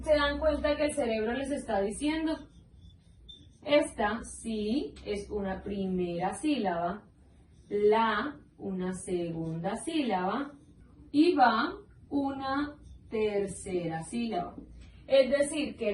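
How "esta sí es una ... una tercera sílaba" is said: slow, deliberate teacher count; slow demo reading. Shown as a demonstration. Teacher showing students how to split syllables. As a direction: count each syllable out slowly. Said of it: "leyendo la palabra lentamente".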